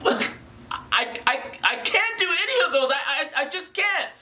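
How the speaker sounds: high, hurried stammering excuse; helpless objector. Retold or imitated. Viewer pleads that he cannot afford treatment, mock-helpless.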